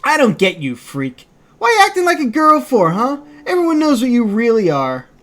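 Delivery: loud, high sneering bully; bully taunting. Acted. Bully sneering at Kaine, loud and high. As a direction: jeer at her like a schoolyard bully.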